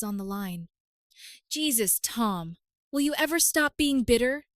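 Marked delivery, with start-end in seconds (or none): 1.5-4.5 angry snap. Acted. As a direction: snap at him, building to a yell. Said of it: "she snapped".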